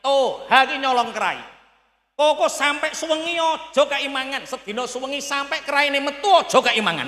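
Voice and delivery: loud scolding rant; scolding father. Retold or imitated. Father scolding his son caught stealing, loud angry scolding. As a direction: tell the boy off loudly. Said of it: "ngoten, Pak".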